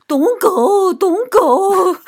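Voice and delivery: high, loud sing-song Indian English; mock Indian English accent. Imitating her child as she leaves, Indian English accent. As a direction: belt out a hammed-up Indian English "don't go". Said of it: "飙那个印度英语".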